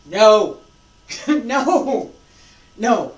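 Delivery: loud sharp no, half-laughing. Shown as a demonstration. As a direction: call it out, then laugh. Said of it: "reacting to a wrong demo".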